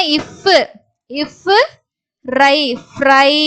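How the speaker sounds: "இஃப்" held apart from "ரை", then blended into "ஃப்ரை"; sounded-out blending. Shown as a demonstration. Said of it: "அப்படின்னு படிப்போம்". ("இஃப்", "ரை" பிரித்து "ஃப்ரை" என சேர்த்து)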